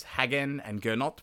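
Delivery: normal talking voice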